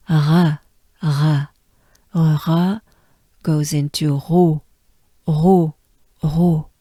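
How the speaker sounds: throaty French "r" into "ro". Shown as a demonstration. Teacher drilling the French r sound. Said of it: "tongue stays put down".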